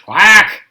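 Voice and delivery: loud quacked reply; loud duck quack. A narrator's reading. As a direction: quack back loudly like a tired duck. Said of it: "The duck answered".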